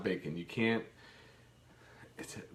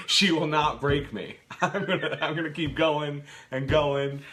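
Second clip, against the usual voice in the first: laughing mock-heroic vow, higher and louder; rozbawienie; 4 semitones higher, 6 dB louder than usual. Real, not acted. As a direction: vow it through laughter, dragging the words. Joking vow amid laughter.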